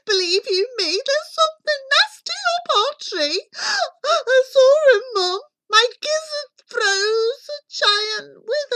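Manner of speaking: put-on high, breathless panicky boy; gasped. A narrator's reading. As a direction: gasp out the frightening news to mum. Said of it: "he gasped".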